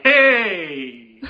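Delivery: drawn-out American Italian greeting; American Italian accent. Acted. Imperial greets the player in mock American Italian. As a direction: stretch it like a caricature American Italian. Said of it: "sound like American Italians".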